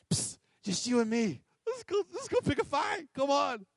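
high-pitched, hushed mock urging; psst, then a squeaky, sneaky nudge to join in; conspiratorial coaxing. Jonathan coaxing his armor bearer into a fight, playfully conspiratorial.

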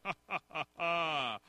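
staccato movie-villain cackle; evil, mocking laugh kept fairly soft; Doctor Evil. Preacher recalling the evil doctor movies, villain laugh. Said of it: "anytime we hear that in the Doctor Evil movies".

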